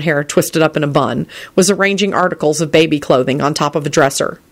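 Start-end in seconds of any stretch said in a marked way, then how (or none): none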